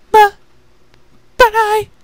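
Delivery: beg, close to tears, tiny voice; frightened little sister. Acted. Pupa, eyes watered.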